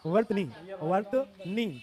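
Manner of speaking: वर्तनी drawn out in separate syllables; slow dictation. Shown as a demonstration. Teacher dictates the word while writing, slowly.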